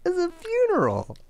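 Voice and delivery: high, disbelieving exclamation; can you believe this guy; incredulous. Real, not acted. Disbelief at the date's request.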